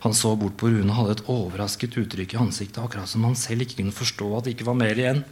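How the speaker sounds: usual speaking voice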